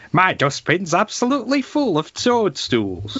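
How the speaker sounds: high-pitched music-hall setup line; hammed-up old-time stage comic; vaudeville comedian. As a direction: belt out the gag's setup like a variety comic. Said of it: "If you're a vaudeville act".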